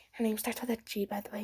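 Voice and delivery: breathy secret whisper to the camera; whispered aside. Real, not acted. Telling viewers a secret about the girl, whispered.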